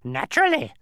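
high, loud bird cackle; cackling bird. A narrator's reading. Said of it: "the bird cackled".

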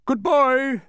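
high, loud kingly call; shouted farewell. A narrator's reading. King calls after the departing boy, shouting. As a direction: call out loudly after the traveler. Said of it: "shouted Azaz".

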